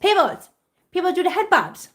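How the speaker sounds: high, insistent pet call; high-voiced plea to a pet; coaxing a pet. Real, not acted.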